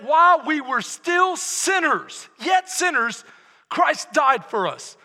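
loud, emphatic scripture proclamation; full-force preaching. Real, not acted. Preacher proclaims Romans to congregation, full-force preaching. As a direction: proclaim the verse to a whole congregation.

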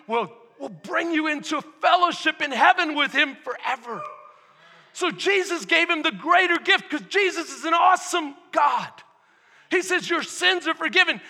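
loud sermon, climbing in pitch; impassioned preaching. Real, not acted. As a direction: drive the promise home to the congregation.

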